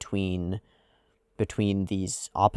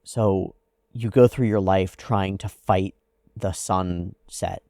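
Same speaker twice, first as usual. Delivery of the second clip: ordinary delivery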